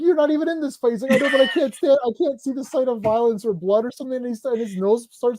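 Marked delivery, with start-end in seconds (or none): 0.0-4.2 high whiny voicing of a feeble actor; lightly hammed-up fainting hero; mock whiny excuse. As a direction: whine it like a squeamish loser. Voicing characters in fight.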